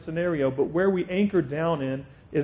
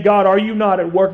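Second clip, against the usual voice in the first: a louder plea, pushing the question up; crying out to God; 3 semitones higher, 9 dB louder than usual. Retold or imitated. Believer crying out to God, a louder pleading question. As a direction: call it out like a desperate prayer. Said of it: "if you cry out to God".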